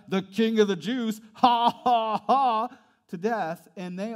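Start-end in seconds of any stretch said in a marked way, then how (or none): 1.3-2.7 mock laugh. Mocking the crucified king.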